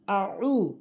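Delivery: আউ with a squeezed-throat Arabic ayn; correct ayn. Shown as a demonstration. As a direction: press the throat in the middle of আউ. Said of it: "বলতে হবে আউ". (আউ গলায় চাপ দিয়ে আইনসহ)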